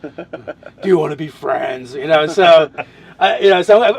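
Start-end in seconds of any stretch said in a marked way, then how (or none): 0.0-1.9 slow, drawn-out lumbering giant; deep slow giant. Shown as a demonstration. Half ogre asking to be friends, deep slow giant. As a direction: put on a big slow giant voice. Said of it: "talk real deep and, and kinda slow".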